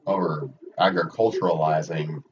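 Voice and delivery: ordinary delivery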